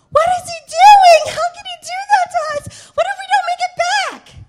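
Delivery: high, loud panicked crying; crying girls. Retold or imitated. Scared girls crying as the coach drives off. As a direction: wail it like a scared teenage girl. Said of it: "girls that would cry".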